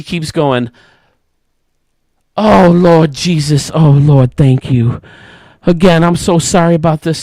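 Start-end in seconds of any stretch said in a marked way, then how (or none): 2.4-7.2 loud thankful moaning. Retold or imitated. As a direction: groan thanks to Jesus. Woman keeps praising while going.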